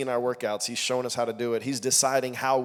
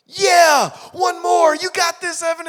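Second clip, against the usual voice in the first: much higher, louder team cheer; team shouting; 10 dB louder, slower than usual. Retold or imitated. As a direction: roar it like a whole team.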